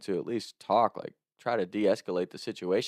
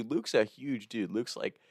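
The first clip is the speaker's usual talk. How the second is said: ordinary delivery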